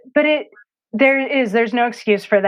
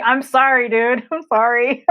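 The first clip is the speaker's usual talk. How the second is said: higher-pitched, amused retold apology; laughing apology; 4 semitones higher, 3 dB louder than usual. Mom answering son who can't read her writing, laughing apology. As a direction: apologize through a chuckle.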